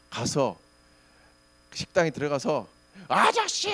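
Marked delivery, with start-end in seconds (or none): 3.1-3.7 high-pitched imagined yell; 소리 지르기. Pretend. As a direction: act out the shout you wanted to give. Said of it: "막 소리 지르고 싶었어요". (높은 소리로 고함치는 흉내)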